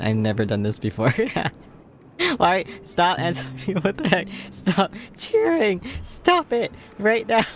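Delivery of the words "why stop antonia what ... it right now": high-pitched giggly pleading, drawn out at the end; flustered laughing protest. Real, not acted. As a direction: beg them to stop through laughter. Flustered, laughing at gift cheering.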